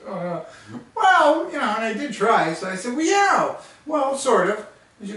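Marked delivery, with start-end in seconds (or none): 3.0-4.7 high, hedging excuse; sheepish. Retold or imitated. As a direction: squirm out of it like a kid.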